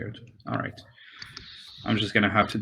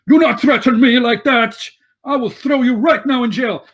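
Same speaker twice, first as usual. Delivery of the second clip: much higher, louder furious warning; angry threat; 11 semitones higher, 6 dB louder than usual. Acted. Town master answers the red brand's threat, furious and loud. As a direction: snap back hard at the threat.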